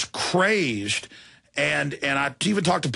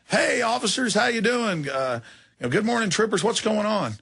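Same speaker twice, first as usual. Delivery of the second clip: overly polite, friendly greeting pitched much higher; 8 semitones higher than usual. Retold or imitated. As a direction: sweeten it up for the troopers. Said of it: "and I politely".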